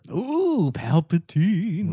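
high "ooh", then a slow, wavering name; mock spooky. Co-host reacting to the villain reveal, mock-ominous. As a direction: draw it out like a playful ghost.

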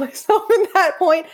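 ordinary talk, nothing marked

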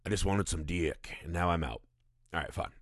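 lightly voiced nun admitting her sins; mock confession. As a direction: shrug it off in a low voice. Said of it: "She goes in and she's like".

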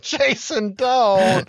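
high pleading warning. Retold or imitated. Wife pleading with Jason not to burn the cash, high. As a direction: beg him to stop, voice shooting up. Wife warns husband burning money.

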